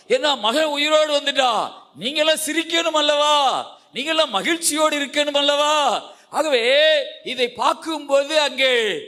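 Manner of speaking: high-pitched, urgent sermon delivery; impassioned preaching. Real, not acted. Preacher telling mourners their son lives, impassioned preaching.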